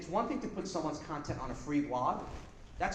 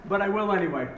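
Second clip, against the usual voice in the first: mock evil; 3 semitones higher than usual. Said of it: "I was so evil".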